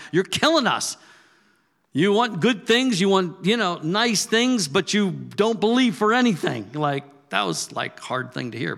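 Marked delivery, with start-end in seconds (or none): 0.1-6.7 higher and louder, pleading and angry; wife's frustrated outburst. Retold or imitated. Wife scolding husband for his negativity, loud and higher. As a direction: throw it at him like a fed-up spouse. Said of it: "Phyllis was ready to kick me to the curb".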